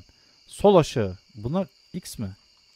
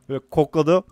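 ordinary talk

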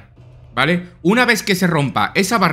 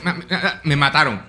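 ordinary talk, nothing marked

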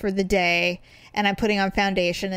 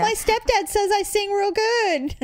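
high, slow, naive contestant voice; hammed-up simple-minded protest; rejected hopeful contestant; 11 semitones higher than usual. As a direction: plead pitched up, mock-wounded. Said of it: "And I'd be saying there".

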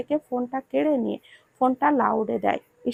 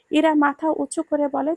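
ordinary delivery

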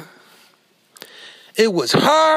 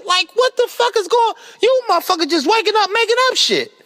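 high, fast disbelieving outburst; exasperated high rant; 8 semitones higher than usual. Real, not acted. Ranting at wannabe promoters, high and exasperated. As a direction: throw your hands up in disbelief.

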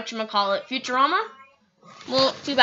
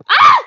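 very loud high shriek; short piercing yelp; startled scream; 13 dB louder than usual. Real, not acted. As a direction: shriek in sudden fright. Startled by pet jumping.

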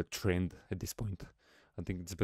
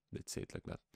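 whispered aside; 10 dB quieter, faster than usual. Real, not acted. Aside after calling stream dry.

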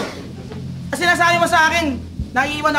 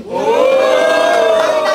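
drawn-out shout; 6 dB louder, much slower than usual. Real, not acted. Drawn-out hype exclamation.

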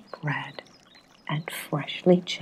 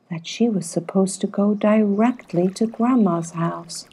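plain reading voice

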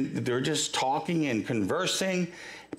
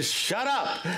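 higher-pitched mock scolding; retold rebuke; 6 semitones higher than usual. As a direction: imitate a crowd telling someone off. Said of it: "they tell him to shut up".

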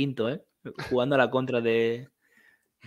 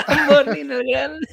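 high, slow, laughing through the name; 12 semitones higher, 6 dB louder, slower than usual. Real, not acted. Laughing while proposing the title to friends. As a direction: chuckle out the grand title.

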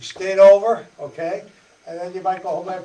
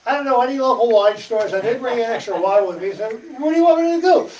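fast, higher, louder pleading son; exasperated son; 6 semitones higher, 10 dB louder than usual. Retold or imitated. Jesus answering his mother at the wedding, exasperated and fast. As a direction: toss it back to Mom, half exasperated. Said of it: "He was just saying".

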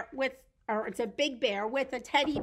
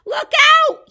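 very high, loud warning; sudden storybook alarm; alarmed shout; 20 dB louder than usual. Acted. Eddie spots something in the woods, alarmed shout.

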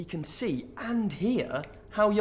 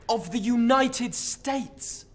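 ordinary talk

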